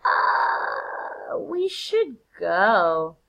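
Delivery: long drawn-out awkward "uh"; uneasy Ross. Acted. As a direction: stretch every word very slowly, edging toward the exit. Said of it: "Ross is like".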